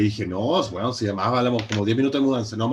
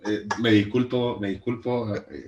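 usual speaking voice

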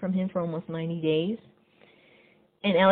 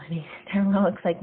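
ordinary talk, nothing marked

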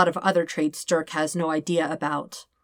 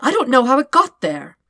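raised voice; 7 semitones higher, 6 dB louder than usual. Acted. Todd and Dirk arguing.

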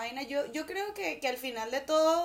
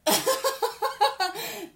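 high, louder laughter; 9 semitones higher, 5 dB louder than usual. Real, not acted. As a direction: laugh at your friend. Laughing at teasing Willy.

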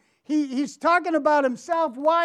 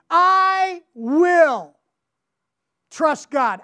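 normal talking voice

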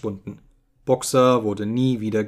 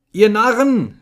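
high, loud, exasperated cry; angry shout; 9 semitones higher, 8 dB louder than usual. A narrator's reading. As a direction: shout it at a crowd of fools. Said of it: "schrie Benjamin".

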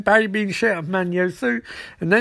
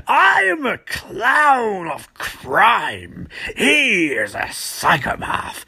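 very slow, drawn-out hammy villain voice; theatrical supervillain; 2 semitones higher, much slower than usual. Retold or imitated. Supervillain correcting being called a psychopath, hammy and theatrical. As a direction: proclaim it grandly, stretching the key words. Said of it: "You, you're both psychopaths".

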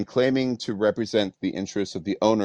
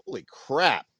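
mock amazement; 8 semitones higher than usual. Mock amazement at Steve Young.

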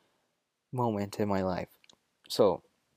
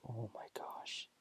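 slow, hushed mock panic; retold horror under the breath; freaked-out whisper; 15 dB quieter, slower than usual. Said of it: "I'm, like, freaking out".

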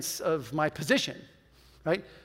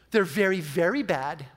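normal talking voice

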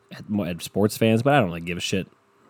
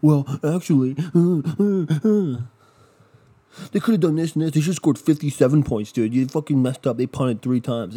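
whiny know-it-all fan, higher and louder; mocking a know-it-all; 4 semitones higher, 4 dB louder than usual. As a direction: sneer it like a nitpicking critic. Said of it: "Everyone has to be fucking mad at something".

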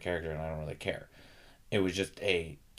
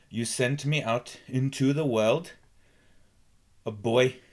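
slower cadence; 4 semitones higher, 5 dB louder than usual. Shown as a demonstration. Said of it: "a lower, a slower and broken".

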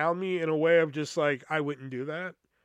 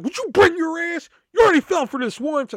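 exasperated scold, much higher and louder; scolding mom; 11 semitones higher, 8 dB louder than usual. Retold or imitated. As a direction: yank a gullible son away from a scam. Mom scolding him to leave.

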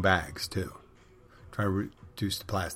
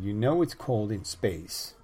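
drawn out and even, a mock menace; first take, slow and measured; slow mock line; slower than usual. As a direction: ease into it slowly. Acted comeback line, repeated.

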